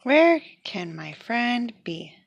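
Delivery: ordinary delivery, nothing marked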